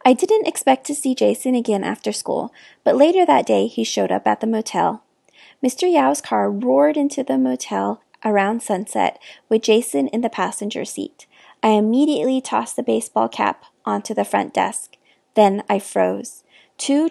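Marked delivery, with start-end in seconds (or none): none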